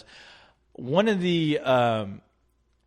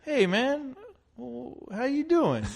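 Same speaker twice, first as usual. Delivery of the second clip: high friendly greeting; 10 semitones higher, 4 dB quieter, much slower than usual. Retold or imitated. Billy Bob greeting him, retold.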